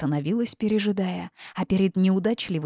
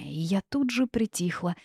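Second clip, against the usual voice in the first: ordinary delivery, nothing marked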